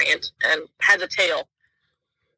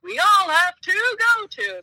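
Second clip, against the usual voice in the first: group chorus voice; 11 semitones higher, slower than usual. Retold or imitated. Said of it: "all of us in a collective group are like".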